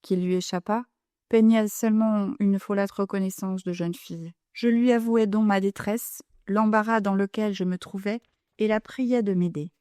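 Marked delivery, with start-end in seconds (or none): none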